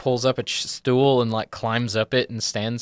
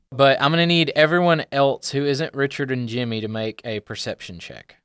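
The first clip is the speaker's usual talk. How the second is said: usual speaking voice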